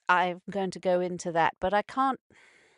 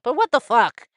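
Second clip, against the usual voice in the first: angry outburst; 2 semitones higher, 8 dB louder than usual. Real, not acted. Outrage at child thrown in pit.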